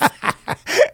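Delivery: high real laugh; high laughter. Real, not acted. Friends joking about Hawking, high laughter.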